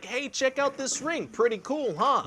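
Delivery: high, slow, singsong boast; mock-innocent, high-pitched offer; naive show-off. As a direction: proudly show off a new toy in a high voice. Acting Frodo showing off the ring.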